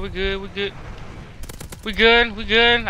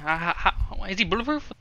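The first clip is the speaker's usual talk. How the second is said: normal talking voice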